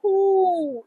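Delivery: high, loud, drawn-out ooh; swooning ooh over a favorite treat; delighted ooh. Real, not acted. Delighted recalling the sorbet.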